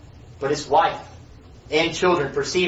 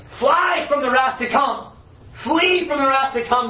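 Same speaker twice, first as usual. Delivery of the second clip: solemn warning cry; 7 semitones higher than usual. Acted. Message read out as warning.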